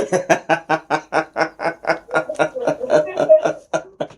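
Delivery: real rolling belly laugh; long laugh. Real, not acted. Host enjoying the guest's new nickname, long laugh. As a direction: keep laughing in a long, steady string of ha-has.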